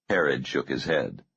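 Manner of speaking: ordinary delivery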